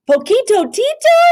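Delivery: high, loud happy shout; exclaimed. A narrator's reading. Scipito greets his friend, excited. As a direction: cry out with delight at an old friend. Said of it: "exclaimed Scipito".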